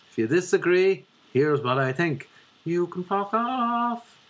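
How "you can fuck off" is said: drawn-out, high-pitched dismissal; slow singsong kiss-off; emphatic send-off. Acted. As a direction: stretch it out high and slow to tell them off. Rant addressed to anyone disagreeing.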